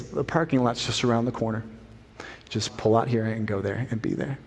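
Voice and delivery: soft, low, kindly retold directions; gentle, gracious reply. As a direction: quietly point the way, no blame at all. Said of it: "God looks at us and says".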